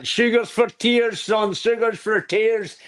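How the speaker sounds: put-on high, slow old nan's saying; lightly voiced scolding grandmother; imitating nan. Quoting nan's old saying to a child, high and slow. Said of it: "All they say".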